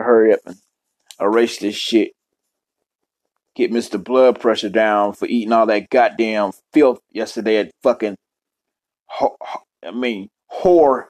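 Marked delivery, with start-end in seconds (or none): none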